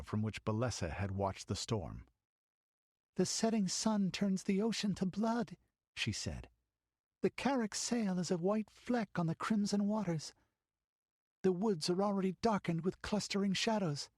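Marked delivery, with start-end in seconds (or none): 3.2-5.6 put-on a girl's voice, much higher; small girl gazing at sunset; young girl. Girl at the window describes sunset, girlish voice. 7.2-14.1 put-on a girl's voice, much higher; small girl gazing at sunset; young girl. Girl at the window describes sunset, girlish voice.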